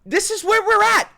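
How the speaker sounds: high, loud exasperated shout. Real, not acted. Ranting to viewers, a loud exasperated shout. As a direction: belt it out in disbelief.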